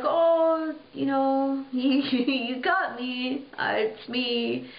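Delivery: high, laughing, drawn-out admission; sheepish confession. Retold or imitated. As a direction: own up with an embarrassed giggle. Girl caught, admits it's her.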